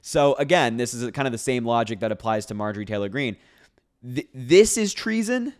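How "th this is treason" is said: pitched-up, slowed disbelief at "treason"; incredulous. Real, not acted. Repeating the post's claim to viewers, incredulous. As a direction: echo the word back in disbelief.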